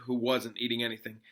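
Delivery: ordinary delivery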